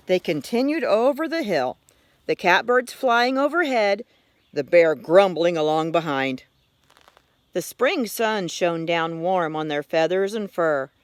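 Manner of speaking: ordinary delivery, nothing marked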